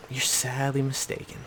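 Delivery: quiet scornful echo. Real, not acted. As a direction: let it sink in quietly. Scolding Rob, said twice.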